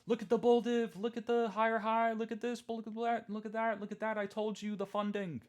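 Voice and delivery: high, loud gushing crypto bull; squealing mock hype over the charts; mocking hyped bull. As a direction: parody an overexcited Twitter chart bro. Mocking hyped crypto Twitter bulls.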